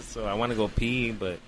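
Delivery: ordinary delivery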